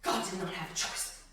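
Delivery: low, soft, sobered statement; hushed repetition. Real, not acted. Preacher letting God's lack of choice sink in, hushed. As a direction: drop it low and quiet, gravely. Same declaration repeated hushed.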